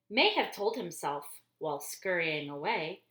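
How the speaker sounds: usual speaking voice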